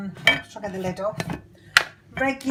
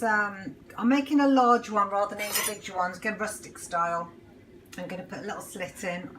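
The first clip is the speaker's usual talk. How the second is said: normal talking voice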